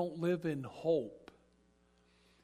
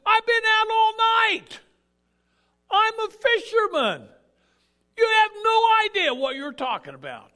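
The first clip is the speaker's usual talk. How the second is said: loud, high-pitched protest; indignant shout; 16 dB louder than usual. Retold or imitated. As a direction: shout back as an insulted fisherman. Peter protests at Jesus' advice.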